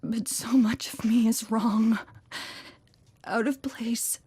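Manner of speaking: hushed, breathless whisper to the one who came for her; barely voiced, as if afraid to be overheard. Trapped AI whispering to her rescuer, hushed whisper. Cortana trapped, calling to Chief.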